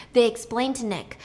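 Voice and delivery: ordinary delivery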